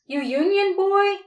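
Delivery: high, loud mocking sneer; jeering soldier. A narrator's reading. Soldier taunts the captured boy with a loud high jeer. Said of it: "one jeered".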